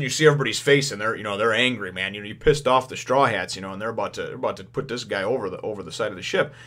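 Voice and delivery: ordinary delivery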